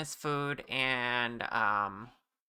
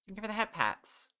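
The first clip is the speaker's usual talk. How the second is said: cutesy voice; 8 semitones higher, 4 dB quieter than usual. Acted. Thanking chat for head pats.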